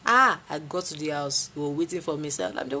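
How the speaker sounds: usual speaking voice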